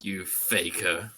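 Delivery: low, slow, quiet put-down; disdainful rival. Acted. Rival dismissing his double, low slow disdain. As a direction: drag it out low and cold. Voicing Shadow-like rival line.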